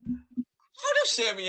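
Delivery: high, loud cry-laugh; laughing helplessly. Real, not acted. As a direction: squeal through laughter.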